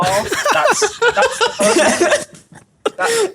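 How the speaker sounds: high squeaky laughter; real giggle breaking in; laughing. Real, not acted. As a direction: laugh through the words. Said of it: "laughing at own accent".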